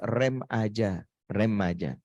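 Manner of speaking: slow, low, drawn-out "rem aja"; slow repeated advice. Shown as a demonstration. Preacher telling mothers to brake, slow and low. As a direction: stretch out "rem aja" like braking gently. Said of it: "ortu dilarang ngegas".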